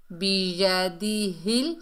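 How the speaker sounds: बियदिहिल slowly, each syllable distinct; correct slow reading. Shown as a demonstration. Teacher models the word, slow careful बियदिहिल. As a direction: stretch बियदिहिल, rising at the end. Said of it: "बियदिहिल नहीं पढ़ना".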